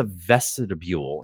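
"vestibule" with an added middle syllable; extra syllable. Retold or imitated. Puzzled student echoes announcer, "vestibule" with an extra syllable. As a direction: say "vestibule" as ves-ti-da-bule. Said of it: "he added an extra syllable".

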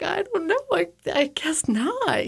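upset skier, near tears, answering her friend; retold tearful reply. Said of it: "I just burst out crying".

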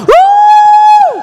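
a very high, loud whoop; excited whoop. Real, not acted. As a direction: let out a joyful whoop.

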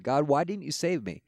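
high, loud desperate cry to God; mock desperate yell to God. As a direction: yell up at the sky. Drowning man yells to God.

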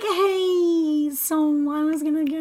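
high, drawn-out goofy call; mock hailing call. Acting out girl hailing driver.

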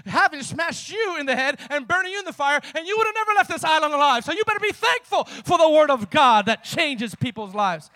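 rising into a loud preaching shout; chief's warning. Retold or imitated. Preacher voicing the chief to the professor, building to a shout. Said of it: "He goes".

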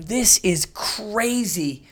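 high, slow, stunned disbelief; high and slow in pure astonishment. Retold or imitated. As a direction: drag it out like you can't believe it. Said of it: "I'm going".

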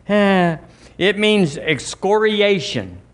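slow, high, weighty stress on the word excoriation; drawn-out emphasis. Acted. Preacher to congregation, stretching out excoriation. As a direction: lean hard into excoriation, slowly.

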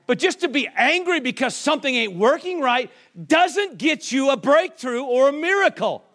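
ordinary talk, nothing marked

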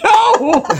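a high, loud yelp of protest; mock-outraged high yelp of denial; shocked no. Real, not acted. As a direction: shriek it, caught off guard. Protests having a kitty corner.

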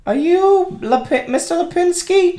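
very high, drawn-out put-on voice; slow falsetto polite call; hammed-up lady enquirer. She approaches a stranger at the trucks, hammed-up high lady voice.

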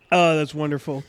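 normal talking voice

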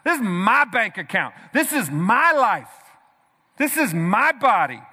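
slow, pressed possessive insistence; preacher mimicking a possessive believer, slow and insistent; mock possessive claim. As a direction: lean hard on my. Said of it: "we start looking around going".

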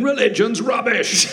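high, slow, hammed-up sneer of a preachy atheist; mocking Dawkins. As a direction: lampoon him by drawing out the slogan up high. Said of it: "who sort of goes".

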